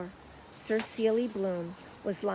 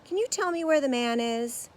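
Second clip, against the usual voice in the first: Paddington voice; 6 semitones higher, 7 dB louder than usual. A narrator's reading. Paddington asks stagehand.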